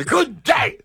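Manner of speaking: very high, curt farewell; Willy Wonka impression. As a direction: bark it out as a furious candy maker. Repeating Wonka's dismissal.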